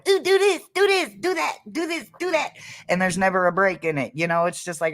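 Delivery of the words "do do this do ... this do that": squeaky high voice, repeating orders; high-pitched nagging voice. Retold or imitated. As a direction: pipe it up high like a pestering little ghost. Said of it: "with the high-pitched voice going".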